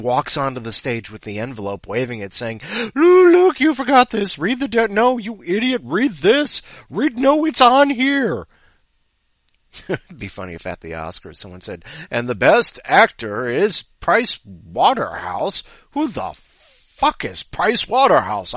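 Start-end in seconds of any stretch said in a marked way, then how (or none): 2.9-8.5 high, loud, frantic squawking; frantic auditor. Acted. Vote tallier waving envelope at presenter, frantic yelling. 12.1-15.6 slow, loud award announcement; Oscar presenter. Acted. Presenter at the Oscars opening the envelope, grand announcement. 15.9-18.5 slow, loud, higher incredulous question; baffled outburst. Acted. Someone at the Oscars reacting to the winner, baffled.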